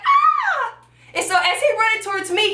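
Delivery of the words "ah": very high-pitched re-enacted scream; freaked-out scream. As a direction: shriek as if someone is running at you. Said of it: "so I freak out like".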